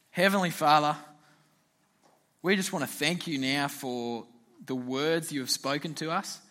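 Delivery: soft, hushed opening prayer. Acted. Youth leader opening prayer for the group, softly. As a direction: pray quietly for the group.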